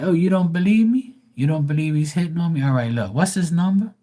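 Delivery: high, taunting challenge; mocking dare. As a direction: dare them, voice riding up. Mock taunting a disbeliever.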